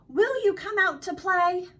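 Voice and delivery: high, loud asking; eager invitation. A narrator's reading. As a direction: call it out as an invitation. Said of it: "when Dingo asked".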